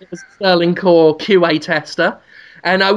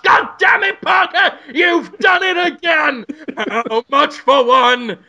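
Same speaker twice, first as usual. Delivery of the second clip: excited shout; 7 semitones higher, slower than usual. Pretend. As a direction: shout it like he can't believe it. Impressed buyer yelling.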